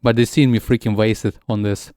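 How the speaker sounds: ordinary talk, nothing marked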